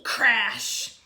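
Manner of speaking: put-on lively read-aloud to kids; storybook reading. A narrator's reading. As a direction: read it like a picture book to the class. Held story narration for children.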